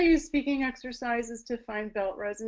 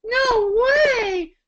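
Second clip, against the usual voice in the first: very high, loud whine; whiny protest; 13 semitones higher, 7 dB louder than usual. Shown as a demonstration. Teacher demonstrating another whiny child line. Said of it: "we'll move those around".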